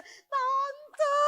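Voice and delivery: very high, drawn-out cry; retold wail. Bereaved child calling to auntie, high imitated wail.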